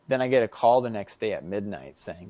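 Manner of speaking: ordinary talk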